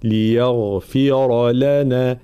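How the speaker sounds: "ليغفر لنا" very slowly, heavy غين and راء, kasra on the فاء; Quranic recitation. Shown as a demonstration. Teacher giving the model reading, very slow. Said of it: "هكذا".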